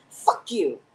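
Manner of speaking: her signature curse. Shown as a demonstration. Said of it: "a very unique way of saying".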